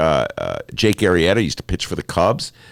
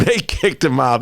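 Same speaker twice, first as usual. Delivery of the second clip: laughing; 5 semitones higher than usual. Real, not acted. Laughing retelling of gator.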